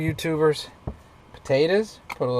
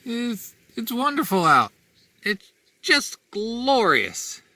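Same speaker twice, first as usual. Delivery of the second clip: sarcastic; 10 semitones higher, slower than usual. Real, not acted. Freezing sleet called wonderful.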